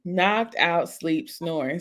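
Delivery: usual speaking voice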